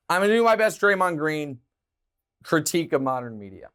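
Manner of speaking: ordinary talk, nothing marked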